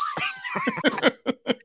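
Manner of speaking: a laugh that jumps way up; high squealing laugh. Real, not acted. Laughing at the friend's voice, high squeal.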